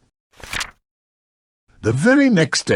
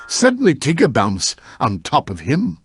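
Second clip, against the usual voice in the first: usual speaking voice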